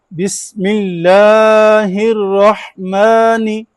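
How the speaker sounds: drawn-out chanted Quran recitation; slow recitation. Shown as a demonstration. Teacher leads class in slow chanted recitation. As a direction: chant each word slowly, leaning on और. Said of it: "ये गलती ठीक कर लें".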